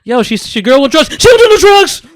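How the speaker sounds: high, loud pleading imitation; imitating a character. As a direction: play the upset boyfriend. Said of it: "trying to break shit down to Jason".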